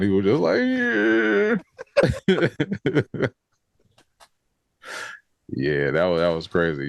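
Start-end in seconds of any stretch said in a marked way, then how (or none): 0.6-1.6 very high drawn-out mock squeal; losing it. Co-host mimics Cole losing it, high squeal. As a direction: imitate someone cracking up, high and long. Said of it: "Cole was losing it at that point".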